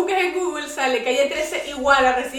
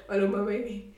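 put-on [name]'s accent; 4 semitones lower, 7 dB quieter than usual. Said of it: "como el acento".

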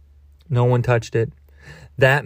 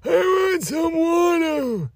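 very high, slow, whimpering plea; squeaky exaggerated whine; whiny high voice; slower than usual. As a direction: beg for water in a tiny squeaky voice. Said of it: "And you're gonna be like".

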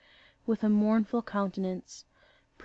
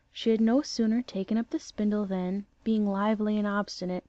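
usual speaking voice

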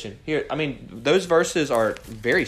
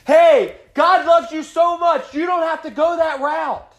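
high, loud pleading call; calling out in the street; 9 dB louder, slower than usual. Pretend. Wisdom calling out to the simple ones, in a loud plea. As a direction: cry it out to someone walking away. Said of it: "No, it yelled out".

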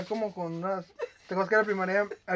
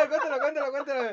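laughing tease; 7 semitones higher than usual. Real, not acted. Teasing urging, laughing.

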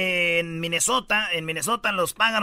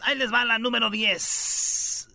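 slow, drawn-out radio announcement of story ten; big showman intro, a touch slower; announcer; 2 semitones higher, slower than usual. Shown as a demonstration. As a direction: present it like a showman. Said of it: "vamos con la diez, señores".

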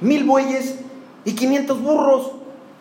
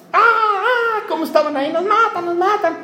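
high-pitched hammed-up cries of people being killed; half-laughing, play the victims yelling for help; mock panicked screams; 9 semitones higher than usual. Preacher acting out herdsmen screaming as they are killed, hammed-up cries. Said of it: "no grita".

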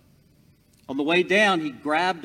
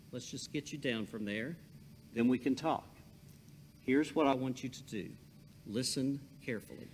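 calm God voice; 8 dB quieter, faster than usual. Acted. God calmly instructs Jack.

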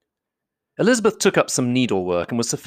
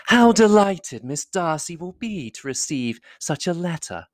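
high, slow society lady voice; high-pitched, drawn-out flattery; gushing flatterer; 7 semitones higher, slower than usual. A narrator's reading. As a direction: coo admiringly over his letter. Miss Bingley flatters Darcy as he writes.

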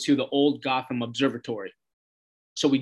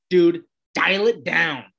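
slow, louder put-on voice; mock Dove warning; 3 semitones higher, 5 dB louder, slower than usual. Dove telling Hank to back off, slow and firm. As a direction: lean on each word, slowly, to calm him.

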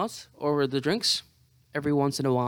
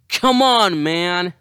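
loud, higher pleading complaint; exasperated groan; 3 semitones higher, 9 dB louder, much slower than usual. Real, not acted. Hungover and in pain, crying out loud. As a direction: complain to the sky.

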